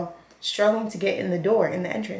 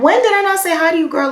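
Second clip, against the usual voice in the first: much higher and louder indignant retort; 9 semitones higher, 7 dB louder than usual. Retold or imitated. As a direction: push back, offended.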